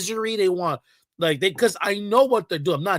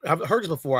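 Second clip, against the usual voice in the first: ordinary delivery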